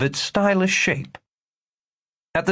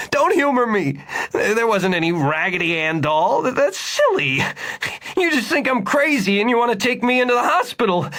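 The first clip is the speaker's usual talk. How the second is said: angry refusal; 11 semitones higher than usual. A narrator's reading. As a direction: refuse him, offended and scared.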